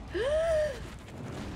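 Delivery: high startled gasp; frightened gasp. Real, not acted. Player spotting the creature, gasping in real fright. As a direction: gasp as if the thing just lunged. Monster appears in horror game.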